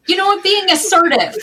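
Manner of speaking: high, emphatic self-justification; playfully defensive. Real, not acted. Playful defense of having yelled.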